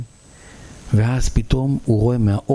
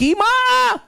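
very high, drawn-out cry; piercingly high call of longing; dying to shout; much slower than usual. A narrator's reading. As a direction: cry out for Mom as if bursting. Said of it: "הוא מת לצעוק".

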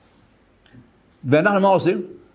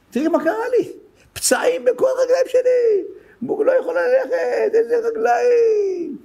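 בוכה. Retold or imitated. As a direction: imitate a woman wailing over her legs, stretching the words high. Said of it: "בכי". (יללת בכי מוגזמת בקול גבוה)

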